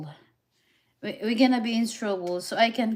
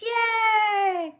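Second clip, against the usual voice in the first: excited cheer; 11 semitones higher than usual. Real, not acted. Excited cheer about Vegas.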